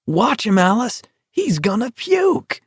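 high-pitched gleeful spectator; delighted cry. A narrator's reading. Spectator pointing out the sick walker to Alice, delighted cry. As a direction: call it out high, relishing the sight. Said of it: "someone cried in an ecstasy of delight".

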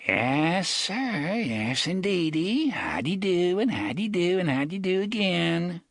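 put-on high, sing-song old gunman; mock-jovial old Jonas. A narrator's reading. Jonas clowning to Reynolds, high and sing-song. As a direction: ham up the folksy greeting.

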